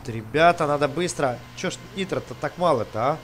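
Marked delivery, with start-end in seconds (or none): none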